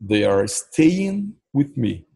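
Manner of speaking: each word slow and clear for learners; slow model question with a rise at the end; slow teaching read. Shown as a demonstration. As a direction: sound it out slowly, like a teacher. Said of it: "teacher models the sentence".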